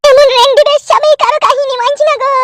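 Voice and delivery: usual speaking voice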